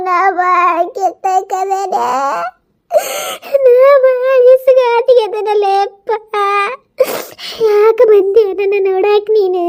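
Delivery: slow, drawn-out tearful lament; tearful wailing. Acted. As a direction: wail each phrase out to the son. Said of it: "ನೀನ್ ಎದಕ್ ಅಳಾಕತ್ತಿ?".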